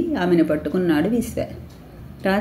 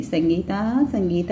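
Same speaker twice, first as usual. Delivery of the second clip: plain reading voice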